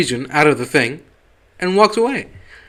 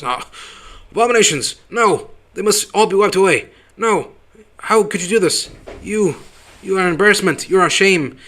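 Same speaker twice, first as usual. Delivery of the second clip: higher, hammed-up outraged bigot; mock outraged old elder ranting; 6 semitones higher than usual. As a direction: ham up a bigot finding out about his daughter. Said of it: "he's like a stereotypical, like, racist".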